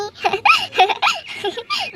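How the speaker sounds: high, uncontrollable giggle fit; helpless giggling. Acted. Mother amused at her son's painted face, helpless giggling. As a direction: giggle too hard to answer. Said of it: "मुझे तो इतनी हंसी आ रही है".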